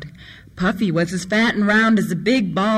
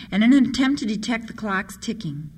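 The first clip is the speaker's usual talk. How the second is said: usual speaking voice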